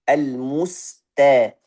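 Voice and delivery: very slow Al-musta with a light ta; Al-musta, sin then thin ta. Shown as a demonstration. Teacher models the right Al-musta, very slow. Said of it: "Ada juga yang baca al-mush".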